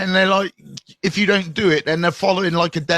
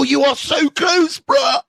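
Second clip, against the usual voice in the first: excited shout; 10 semitones higher than usual. Real, not acted. Cheering a viewer close to the follow goal, excited shout. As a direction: shout him on, he's nearly there.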